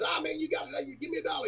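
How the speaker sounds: high, soft pestering little guy; quiet, high-pitched put-on whine of a panhandler; tiny man's voice. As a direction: keep begging in a small high voice. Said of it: "He goes".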